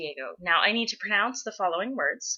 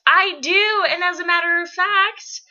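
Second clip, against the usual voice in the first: high and loud with excitement; bubbling over with excitement; excited; 10 semitones higher, 6 dB louder than usual. Real, not acted. Excited about sister visit.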